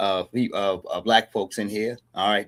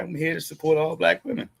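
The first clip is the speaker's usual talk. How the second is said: higher, lightly acted pledge of support; lightly voiced supporter; 7 semitones higher than usual. Man showing how men back their sisters, lightly acted rallying voice. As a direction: lightly play a supporter declaring loyalty. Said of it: "we would go ahead to say that".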